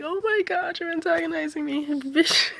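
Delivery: high-pitched, hammed-up tearful whine breaking into a laugh; mock crying. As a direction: imitate someone bursting into fake tears. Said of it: "she starts crying like".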